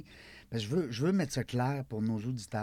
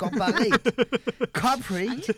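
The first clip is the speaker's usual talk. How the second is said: "Corporate" said the French way, high and loud with the stress on the last syllable; mock French accent; 11 semitones higher, 8 dB louder, slower than usual. Shown as a demonstration. Québécois host jokes he's taken on his French accent today, hamming it up. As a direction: play up a French-from-France "Corporate" for laughs. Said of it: "j'ai pris mon accent français aujourd'hui".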